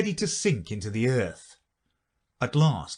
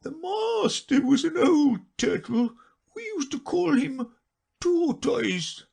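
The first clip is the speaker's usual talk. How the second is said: high, slow, tearful turtle voice; a weepy creature fighting back sobs; a touch of sobbing, high and slow; sobbing a little; slower than usual. Acted. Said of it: "though still sobbing a little".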